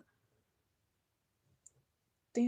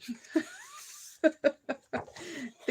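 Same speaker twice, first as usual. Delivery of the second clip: a real, drawn-out laugh at her own joke; 9 semitones higher than usual. Real, not acted. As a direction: laugh it off, amused. Laughs after joking "Finglish.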